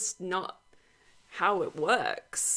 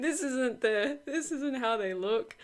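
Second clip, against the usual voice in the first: higher, amused imitation of objectors; mock complaint; 6 semitones higher than usual. Creator laughingly quoting commenters' objections, mock complaint.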